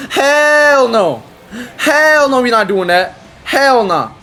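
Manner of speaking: loud, high, drawn-out protest; high-pitched gamer outburst; emphatic refusal. Real, not acted. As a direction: belt out a stretched, outraged refusal. Emphatic refusal during live game.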